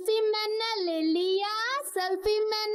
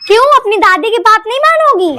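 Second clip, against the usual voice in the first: much louder, calling grandma; loud grandmother; 17 dB louder, faster than usual. Acted. Grandmother calls granddaughter to fetch water, loud and bossy. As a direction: call out like a bossy old granny.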